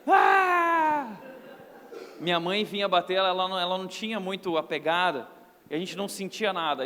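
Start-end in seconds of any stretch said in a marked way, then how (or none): 0.1-1.2 high, loud mock yelp of pain; mock cry of pain. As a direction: yelp like a spanked kid. Child being spanked cries out. (grito fingido de dor, agudo e alto)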